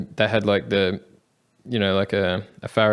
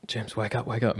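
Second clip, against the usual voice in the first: urgent hushed wake-up; 8 dB quieter than usual. Retold or imitated. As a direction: hush it, but urgently: wake him up. Friend waking him on bus.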